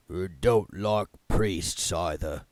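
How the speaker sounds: low, very slow, hollow and mournful; a gloomy voice from beneath the chair; sad, hollow. A narrator's reading. Voice under the chair.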